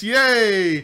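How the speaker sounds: high, loud sarcastic yay; mock cheer. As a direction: cheer with fake excitement.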